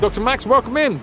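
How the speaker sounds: announcer welcome. Real, not acted. Announcing new viewer.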